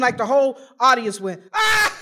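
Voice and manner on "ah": mock crowd guffaw. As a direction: bark out the crowd's laugh. Audience laughing at the story.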